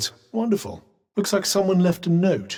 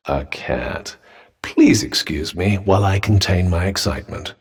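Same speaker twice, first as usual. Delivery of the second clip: low, unimpressed drone; deadpan sarcasm; 10 semitones lower than usual. Acted. Companion mocking the fuss over a cat, deadpan sarcasm. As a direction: drop the pitch low, drily feigning excitement.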